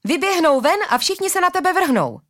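high-pitched urgent warning; shouted warning. A narrator's reading. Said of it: "křikla na hlídače Arila".

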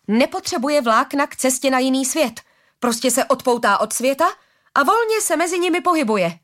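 high-pitched, eager rush of discovery; excited explaining. A narrator's reading. Harari explains Střeporoch's travel to Arila, excitedly. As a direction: explain it with rising enthusiasm.

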